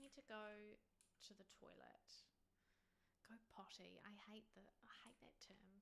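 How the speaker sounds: whispered dislike, no voice. Real, not acted. Streamer whispering about a word she hates. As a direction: hiss it under your breath.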